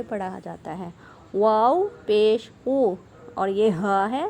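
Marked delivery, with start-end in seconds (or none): 1.3-2.9 slow, loud او with fully rounded lips. Shown as a demonstration. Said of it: "ہونٹوں کو گول کر کے پڑھیں گے". (گول ہونٹوں سے او)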